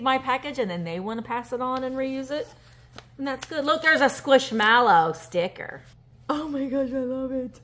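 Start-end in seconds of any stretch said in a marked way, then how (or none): none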